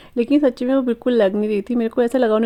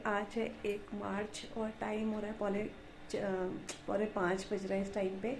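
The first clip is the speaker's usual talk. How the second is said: hushed, slightly rough post-nap voice; speaking softly; 14 dB quieter than usual. Real, not acted. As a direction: keep your voice down, just woken from a rest. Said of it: "मैं धीरे बोल रही हूँ".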